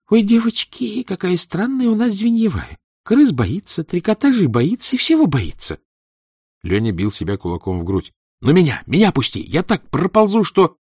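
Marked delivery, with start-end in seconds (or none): 0.1-5.8 put-on high squeaky taunting girl; squeaky mocking girl. Vava mocks the leader. 8.4-10.8 eager pleading. Acted. Lyonya begs loudly.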